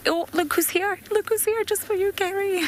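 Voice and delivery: very high, sing-song teasing voice; playful squeal. Pretend. Guide teasing Gary about who has turned up, playful squeal. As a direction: pitch it way up in mock excitement. Mock excited call to Gary.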